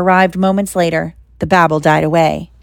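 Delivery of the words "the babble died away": ordinary delivery, nothing marked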